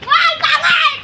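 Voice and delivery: high, startled cry of alarm; panicked shout as trouble starts. Acted. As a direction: yell it out in a panic. Skit opens with alarmed shout.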